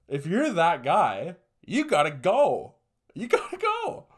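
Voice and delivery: drawn-out, exasperated yell; emphatic exclamation. Acted. Urging the absent guy to leave.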